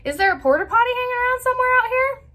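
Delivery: high, rushed, sing-out question; teasing family joke. Retold or imitated. Kids teasing mom on car rides, mock-innocent question. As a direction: rattle it off high to needle someone. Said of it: "would just kinda chuckle to ourselves and say".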